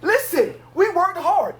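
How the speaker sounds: yelling. Real, not acted. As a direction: pitch it up and demand it. Heated debate, demanding money.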